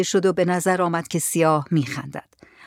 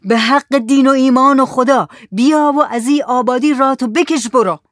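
higher, louder command to leave; loud shout; 6 semitones higher, 5 dB louder than usual. Acted. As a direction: shout it out as an adjuration. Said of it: "با صدای بلند داد زد". (فریاد بلند و آمرانه)

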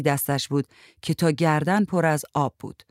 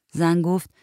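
usual speaking voice

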